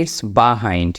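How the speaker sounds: "behind" slowly, as "buh-hind"; corrected pronunciation. Shown as a demonstration. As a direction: open the first vowel of "behind" to buh. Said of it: "এইটার উচ্চারণটা হবে". ("behind"-কে "বাহাইন্ড" উচ্চারণ)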